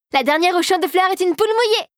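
high teasing race call; fairy dares Marie to race, teasing and high; playful challenge. A narrator's reading. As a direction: dare her friend, high and playful. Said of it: "lança-t-elle".

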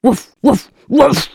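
loud woof woof, barked out high; mock dog bark; big barking. A narrator's reading. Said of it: "Un très gros aboiement sort de sa bouche".

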